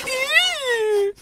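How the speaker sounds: shrieking high laugh; high squealing laugh. Real, not acted. Squealing laugh at own joke.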